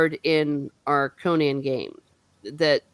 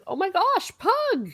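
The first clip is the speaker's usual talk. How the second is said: much higher gasp of disbelief; mock shocked squeal; 14 semitones higher than usual. Reader recalling her shock at Pug's fate, high squeal. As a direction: squeal his name in retold shock. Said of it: "And I was like".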